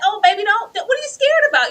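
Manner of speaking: high, loud pleading daughter voice with a laugh in it; a daughter half-laughing as she begs her father; daughter's high plea. Retold or imitated. Voicing daughter confronting dad.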